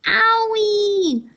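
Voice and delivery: shrill loud crone yelp; high scream. A narrator's reading. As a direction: shriek as she shoots upward. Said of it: "she screamed".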